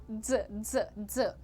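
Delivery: ज repeated as a short, low dz; pizza-like dz sound. Shown as a demonstration. Teacher drilling the ending of clothes, low buzzed ज. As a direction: drill ज, buzzed, with gaps between. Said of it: "जैसे पिज्जा में ज आता है".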